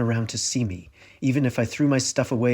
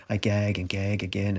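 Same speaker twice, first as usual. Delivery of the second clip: ordinary delivery, nothing marked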